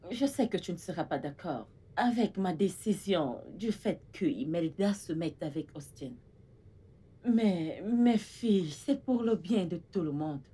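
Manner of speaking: soft, low, careful explaining; gentle persuasion. Acted. Mother justifying her decision to her daughters, gentle persuasion. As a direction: break it to them softly.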